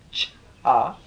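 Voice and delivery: Cha with a strong puff of breath after the ch; aspirated cha. Shown as a demonstration. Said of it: "With a real breath in it".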